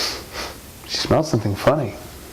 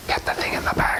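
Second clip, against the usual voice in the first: soft unvoiced whisper; whispered aside; 5 dB quieter than usual. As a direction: whisper it behind a hand. Aside to Mister Squiggly.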